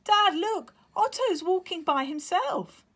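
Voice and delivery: very high, loud thrilled little chick; excited chick. Acted. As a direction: tell Dad with amazement. Leo voiced excited.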